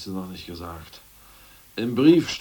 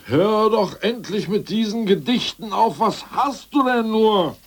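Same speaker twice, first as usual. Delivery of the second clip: high, loud exasperated outburst; irritated and louder; 11 semitones higher, 7 dB louder than usual. Acted. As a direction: snap at him to stop. Irritated at the poems.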